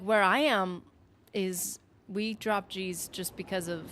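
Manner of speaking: ordinary delivery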